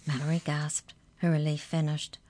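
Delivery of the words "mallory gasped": ordinary delivery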